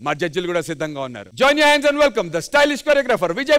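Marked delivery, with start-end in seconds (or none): 1.4-3.6 slow, high announcer call; stage introduction. Acted. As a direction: drag out the welcome grandly. Introducing judges.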